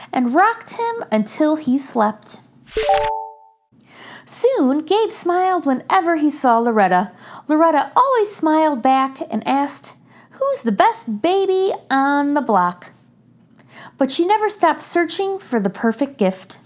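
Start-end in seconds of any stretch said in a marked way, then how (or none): none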